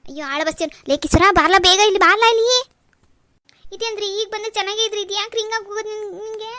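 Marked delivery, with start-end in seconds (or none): 0.9-2.7 loud shout across the yard; urgent call. Acted. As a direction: yell for Kisara to come quickly. Wife calls servant urgently. (ಜೋರಾಗಿ ಕೂಗಿ ಕರೆಯುವುದು)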